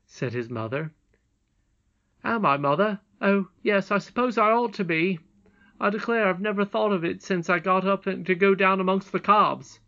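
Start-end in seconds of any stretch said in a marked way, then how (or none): 2.2-9.8 put-on high, loud boy's voice; animated realisation, pitched up; surprised boy. A narrator's reading. Curdie answering his mother about his leg, surprised.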